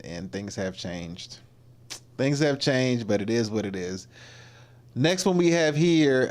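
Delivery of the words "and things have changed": much softer, slow and resigned; soft resigned murmur. Real, not acted. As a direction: let it sink, quietly giving up. Somber aside on changed times.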